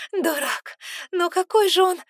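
high, loud laughing protest; playful exasperation. A narrator's reading. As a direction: squeal it in delight and exasperation.

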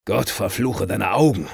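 put-on drunken roaring curse; furious curse. A narrator's reading. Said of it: "brüllte er". (wütender Fluch)

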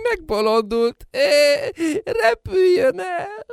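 high wailing laugh into sobs; hysterical crying. Acted. Mother breaking down at the madness, high crying wail. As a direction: shriek it through tears.

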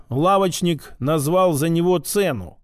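ordinary delivery

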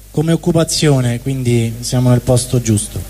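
ordinary delivery

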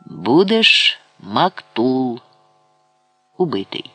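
very slow, grim pronouncement; drawn-out verdict, understated rage; furious sentence. A narrator's reading. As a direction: pronounce death with contained fury, word by word. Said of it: "крикнув перекривлений од люті Сулейман".

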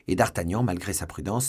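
ordinary delivery

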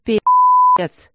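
a sharp, very loud high curse; loud swear. A narrator's reading. Max swears in despair, very loud and high. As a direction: explode with an oath. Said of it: "выругался он снова".

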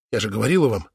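low, almost to herself; insisting. A narrator's reading. Sister insists, repeats herself. (тихо, потрясённо)

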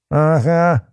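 high-pitched mocking agreement; grinning sarcasm. A narrator's reading. Policeman mocks the boy's claim, grinning sarcasm. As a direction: agree with a jeering grin. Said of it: "осклабился Валентин".

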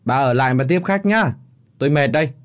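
loud, curt and sulky; old man brushes off his wife, loud and curt; curt dismissal. A narrator's reading. Angry father dismissing wife and guests, loud and curt. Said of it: "hắng giọng".

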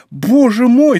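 loud, high cry of astonishment; overjoyed disbelief, full voice; amazed repeating. A narrator's reading. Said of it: "твердил Планше".

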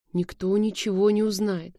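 ordinary delivery

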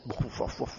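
soft unvoiced beatbox puffs. Shown as a demonstration. Teacher imitating beatbox added to salawat, soft puffs. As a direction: puff out a quiet beatbox rhythm. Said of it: "Atau beatbox".

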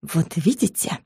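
soft menacing hiss; hushed, close-up threat; threatening whisper. A narrator's reading. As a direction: hiss it quietly as a warning. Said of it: "угрожающим шёпотом прошипел".

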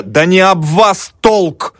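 high, drawn-out exasperated interruption; interrupting. A narrator's reading. Mavra cutting off the student's tirade, exasperated. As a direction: cut in high, stretching each word out. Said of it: "перебила Мавра".

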